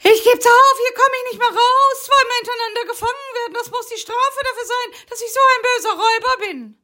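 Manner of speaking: very high whiny voice; whimpering bug. Acted. Rocky stuck in the web, high whimpering complaint. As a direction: wail your misery theatrically. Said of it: "leise vor sich hin wimmerte".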